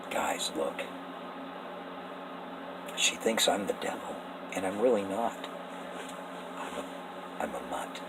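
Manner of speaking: hushed, secretive plea to the big brothers; pleading whisper. Acted. The devil whispering to the brothers to win them over, hushed plea. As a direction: whisper it like a confession nobody else should hear.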